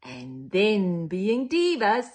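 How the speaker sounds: lively picture-book storytelling; reading to children. A narrator's reading. Storyteller reading to children, lively and sing-song. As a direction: read it like bedtime story time for little ones.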